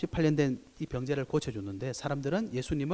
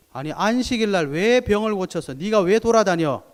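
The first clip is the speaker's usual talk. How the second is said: loud, higher scolding of the healed man; mock scolding; 5 semitones higher, 7 dB louder than usual. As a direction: scold him like an indignant Pharisee. (꾸짖는 흉내)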